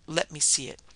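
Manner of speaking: ordinary delivery, nothing marked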